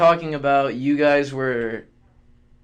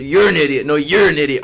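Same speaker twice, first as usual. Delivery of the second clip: mock bar argument; 7 dB louder than usual. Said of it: "arguing at the bar".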